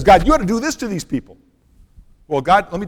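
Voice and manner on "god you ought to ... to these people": higher and louder, a retold angry outburst at God; angry demand. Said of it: "tells God how angry he is".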